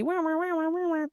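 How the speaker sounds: high, soft mocking wah-wah noise; a teasing spooky wah wah wah, tossed off.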